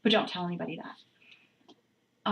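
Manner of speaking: hushed confiding whisper. Real, not acted. Whispering a secret to the viewers. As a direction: let them in on a secret.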